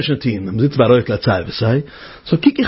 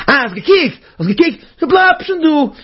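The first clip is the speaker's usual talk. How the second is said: high, quick-fire gotcha; taunting tempter; 13 semitones higher, much faster than usual. Acted. The Yetzer Hara to the person who looked, taunting. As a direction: pounce triumphantly in a high voice.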